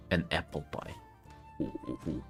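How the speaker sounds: normal talking voice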